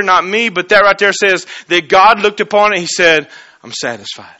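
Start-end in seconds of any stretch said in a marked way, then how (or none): 3.6-4.4 much lower and softer, a final verdict; low hushed God. Acted. God looking on the cross and declaring it done, very low and soft. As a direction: breathe it out, low and settled.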